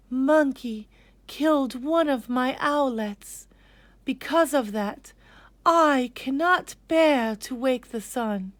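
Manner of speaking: a hint of a grieving mother; grieving mother owl. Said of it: "Mother Owl said".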